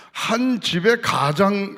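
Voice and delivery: very slow, weighted opening; deliberate emphasis. Real, not acted. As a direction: lay the phrase down slowly. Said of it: "slow emphatic start".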